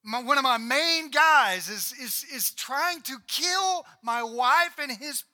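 hammed-up outraged shout; raging king. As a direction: bellow it like a betrayed king storming out. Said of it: "So he stormed out in a rage".

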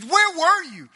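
high, loud mock-angry questioning; angry demand. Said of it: "and I'm just mad".

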